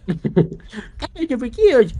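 high, loud, drawn-out mockery; exaggerated teasing mimicry; mocking friend impression. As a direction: parody your friend in a slow, high, silly voice. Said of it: "We're doing a perfect impression".

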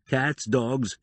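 plain reading voice

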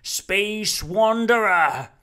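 slow, drawn-out summons over a crowd; calling out. Acted. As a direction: hail him from a treetop. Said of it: "called Rumford up in his treetop".

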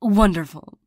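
ordinary talk, nothing marked

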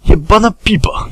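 loud high shout of the hunters. Acted. Hunters yelling their insult at Krzyś, loud high shout. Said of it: "wykrzyknęli łowcy".

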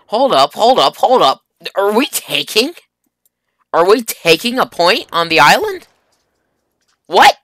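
loud, high disbelieving questions; stopping short in surprise; surprised. Real, not acted. Surprised at capturing point.

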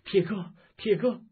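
high, loud cry out of a dream; startled cry. Acted. Wife waking suddenly and calling her husband, startled shout. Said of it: "梦中突然惊醒大叫".

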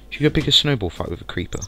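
normal talking voice

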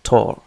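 "Tor" said alone and punched; the stressed syllable tor on its own; isolated stressed syllable. Shown as a demonstration. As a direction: give "Tor" by itself with weight. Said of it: "الشدة توجد على هذا المقطع".

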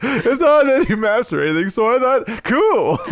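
put-on creaky old adventurer reading his diary; old traveler voice. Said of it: "we adopted that voice".

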